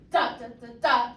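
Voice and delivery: "da" syllables hit loud on the accents; strong emphasis. Shown as a demonstration. Teacher has students copy the accent pattern, heavily stressed. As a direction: punch out the accented "da" hard. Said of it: "I mean emphasis".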